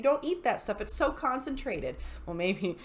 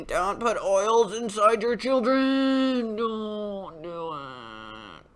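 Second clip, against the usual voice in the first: drawn-out plea; 3 dB louder, much slower than usual. Real, not acted. Pleading mock-dramatic over video.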